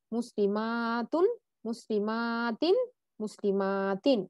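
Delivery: slow মুসলিমাতুন and মুসলিমাতিন, মা held long in each; extra-long vowel. Shown as a demonstration. Teacher repeating the plurals so learners remember, মা stretched long. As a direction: draw out the মা before each final তুন or তিন. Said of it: "আবার বলি দেখেন".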